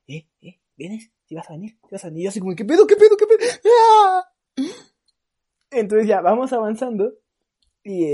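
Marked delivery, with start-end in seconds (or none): 0.1-2.2 soft, hushed stranger's murmur; creepy whisper. Retold or imitated. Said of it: "me iba como que susurrando". 2.6-4.2 much higher, louder frightened cry; panicked yelp. Retold or imitated. Kid panicking at stranger.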